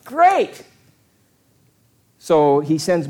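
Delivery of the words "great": a jump much higher on the second great; mock-delighted crowd; excited crowd. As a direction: cheer it with rising thrill. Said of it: "They go".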